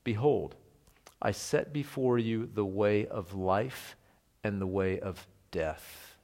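slow, solemn, hushed and weighty; solemn slow reading. Shown as a demonstration. Preacher quoting Jeremiah's warning to the people, slow and solemn. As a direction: let each word land like a verdict. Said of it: "Jeremiah says these words".

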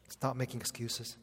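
ordinary talk, nothing marked

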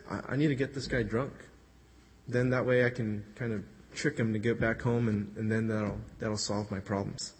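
a touch of a schemer plotting under his breath; scheming to himself. Retold or imitated. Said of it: "So then David says".

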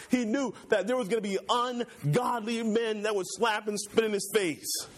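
full-force preaching. Acted. Preacher to church, rising in pitch to full fervor. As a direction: lift the congregation toward the cross.

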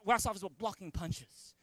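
usual speaking voice

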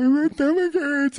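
put-on high little-kid whine. Sick kid telling mom his stomach hurts. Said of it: "I'd be like".

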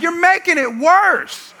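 high, loud, drawn-out parental complaint; exasperated yell. Retold or imitated. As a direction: yell it slowly at botching kids. Retold exasperated yell at kids.

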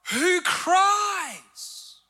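preached shout. Acted. Preacher to congregation about a God who cries, shouting. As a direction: shout it out, rising high.

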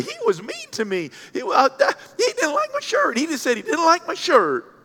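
high-pitched tattletale whine; mock whining. Offended visitor tattles about the shirt verdict, mock whining. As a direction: whine like a wounded complainer, hammed up. Imitates offended staff member complaining.

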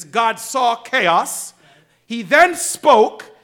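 usual speaking voice

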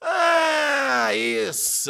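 long, high cry of relief; hammed-up relieved realisation. A narrator's reading. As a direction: let the tension out in a drawn-out ah. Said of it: "aliviou Sócrates".

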